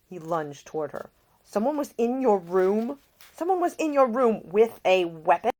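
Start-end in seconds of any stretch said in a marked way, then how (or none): 1.4-5.5 higher, louder alarmed man; alarmed Nick. Acted. Nick learns Ida was threatened, alarmed and louder. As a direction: voice Nick catching the danger.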